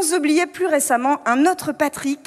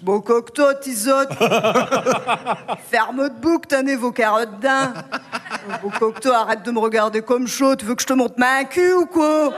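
put-on coarse Ch'ti accent, slurred and drunk; 2 semitones lower than usual. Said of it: "je retrouve mon accent ch'ti et mon côté beauf".

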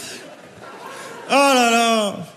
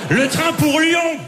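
station announcer; 8 semitones higher, slower than usual. Pretend.

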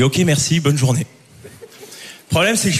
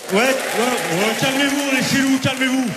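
mock calming; 6 semitones higher than usual. Comedian teases the French in the crowd, hushing them in mock calm. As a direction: settle the crowd down, teasing.

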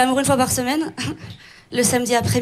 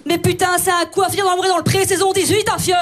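worked-up broad Belgian outburst; Belgian accent; 3 semitones higher than usual. Acted. Comedian loses her temper, strong Belgian accent. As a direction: let a thick Belgian accent burst out. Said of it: "mon accent belge revient très fort".

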